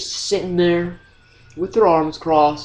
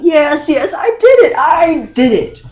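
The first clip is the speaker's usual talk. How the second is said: loud, high, mock-defiant outburst; exasperated yelled confession; 7 semitones higher, 7 dB louder than usual. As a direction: blurt the admission at full volume.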